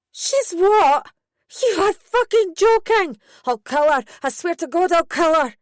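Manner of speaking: shrieking high and loud in fury; screaming. A narrator's reading. Mum screaming at her daughter from the bath, furious high shriek. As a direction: scream it at the top of her lungs. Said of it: "I heard the screams".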